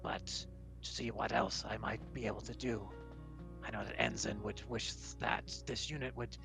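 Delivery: measured, formal robot-like voice; a solemn machine stating its purpose; metallic construct, earnest. Acted. Sabrak tells of a departed friend's wish, earnest metallic voice.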